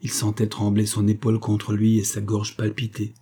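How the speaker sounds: plain reading voice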